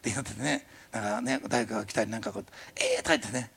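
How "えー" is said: acted "eh?!" of disbelief; amazed reaction. Preacher imagining answered prayer, amazed. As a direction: react as if astounded by a miracle. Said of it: "もう驚き".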